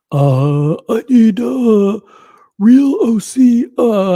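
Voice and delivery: high, loud, drawn-out put-on voice; AI Mike the Snake. Streamer reads a super chat in a requested voice, high AI Mike the Snake impression. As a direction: do a high-pitched, halting AI character impression. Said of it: "this is a requested, uh, voice".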